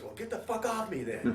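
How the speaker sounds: annoyed buddy. Retold or imitated. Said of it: "Larry goes".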